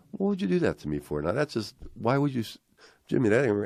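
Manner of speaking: high-pitched, broken-off protesting; upset protest. Retold or imitated. Nicky protests to his brother about the news, upset.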